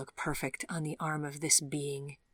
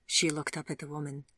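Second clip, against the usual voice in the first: ordinary delivery, nothing marked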